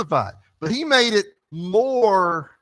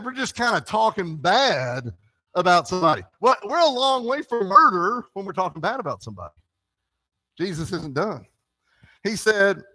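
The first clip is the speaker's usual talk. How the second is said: ordinary delivery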